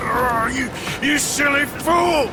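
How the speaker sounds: anguished shout. Acted. Anguish over a sacrificed friend.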